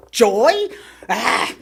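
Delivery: loud startled "Joy? Ah!"; as if it suddenly dawns on you; surprised realization. Retold or imitated. Realizing what she lost.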